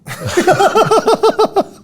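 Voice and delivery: a high, loud burst of laughter; big laugh. Real, not acted. Host laughing at his own joke, loud high laugh.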